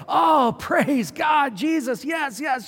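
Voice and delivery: high, loud, hammed-up praise shout; mock a new convert's ecstatic yes; joyful outburst. A hearer receiving the word with great joy, shouting. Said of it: "receive it with great joy".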